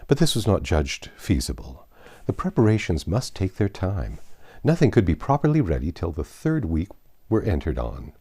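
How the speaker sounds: usual speaking voice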